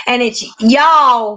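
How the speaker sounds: y'all said slow and drawn out as one syllable, yah; y'all pronunciation demo. Shown as a demonstration. Teaching viewers how to say y'all, slowly. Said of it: "Y A apostrophe L L".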